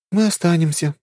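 high, resigned sigh; sighing young woman. Acted. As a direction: give in with a sigh. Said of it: "вздохнула Аня".

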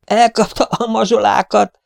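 high, urgent yell; high-pitched, horrified cry; alarmed shout. A narrator's reading. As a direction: shout the bad news to the crew. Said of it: "ordította Raskal".

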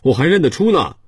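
put-on high, piping child's voice; insistent little boy. A narrator's reading. Boy answering a poor child's denial, high and insistent. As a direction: insist in a high boyish pitch.

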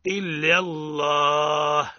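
إلا الله with full tafkhim on the lam; slow, emphatic dark لام in الله; heavy lam. Shown as a demonstration. Teacher demonstrating tafkhim, slow chant. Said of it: "يقرأ هكذا بالتفخيم".

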